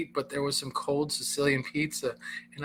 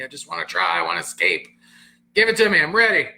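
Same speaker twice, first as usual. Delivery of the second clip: eager rebel; 2 semitones higher, 9 dB louder, faster than usual. Retold or imitated. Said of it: "I'm this rebellious".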